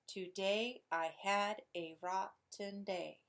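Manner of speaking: slow Today I had a rotten day, syllable by syllable; measured beat landing on DAY, HAD, ROT, DAY; iambic stress. Shown as a demonstration. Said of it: "unstressed, stressed, unstressed".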